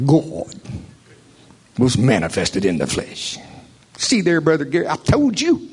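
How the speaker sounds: nasal mocking preacher voice; whiny nasal impersonation of a preacher; nasal creature voice. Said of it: "in [name]'s best nasalated creature voice".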